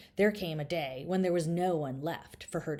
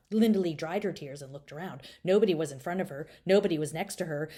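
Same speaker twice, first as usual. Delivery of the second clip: ordinary delivery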